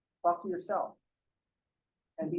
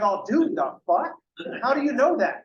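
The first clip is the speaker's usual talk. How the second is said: rising to a loud, much higher disbelieving cry; mock outrage at an absurd answer, climbing higher and louder; baffled disbelief; 7 semitones higher, 3 dB louder than usual. Mock student baffled by farmer.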